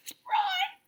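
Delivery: very high squeaked name. A narrator's reading. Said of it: "he squeaked".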